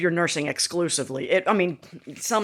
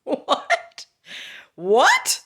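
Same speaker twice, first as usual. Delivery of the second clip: shocked what; 9 semitones higher than usual. Retold or imitated. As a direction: gasp it out in disbelief. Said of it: "I was like".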